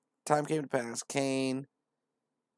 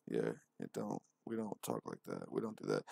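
much lower, softer gruff mumble; mock deep voice; 13 semitones lower, 9 dB quieter than usual. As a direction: drop it down like a tough guy.

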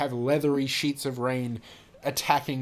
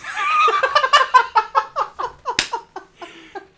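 long, loud, high cackle of laughter; loud laughing fit; 11 dB louder than usual. Real, not acted. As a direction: lose it completely. Bird line cracks her up.

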